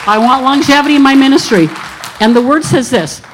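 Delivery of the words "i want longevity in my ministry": louder, higher declaration to God; bold declaration. Retold or imitated. Telling God she wants longevity in ministry, bold and loud. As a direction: claim it boldly, raised up. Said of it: "So I would tell God".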